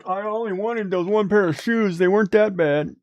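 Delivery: put-on high whiny child pleading; whiny kid voice. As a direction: play a sulky kid begging mom. Mock child pleading with mom.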